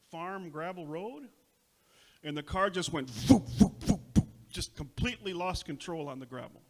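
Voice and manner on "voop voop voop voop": loud mimicked voop of a skidding car; skidding noise. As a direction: make the car skid sound.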